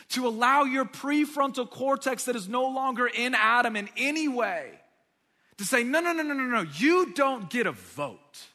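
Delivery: high, loud, emphatic refusal; fiery preacher outburst; preaching at full force. Acted. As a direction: shout it down from the pulpit.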